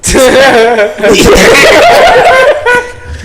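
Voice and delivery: long, loud burst of laughter; a big real laugh. Real, not acted. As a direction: crack up hard.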